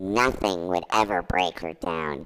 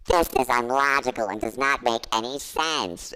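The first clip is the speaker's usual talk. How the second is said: usual speaking voice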